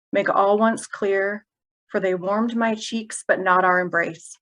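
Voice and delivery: measured elegy in short, broken phrases; elegy recitation. Acted. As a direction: recite haltingly, pausing between fragments of verse. Reads elegy for sister.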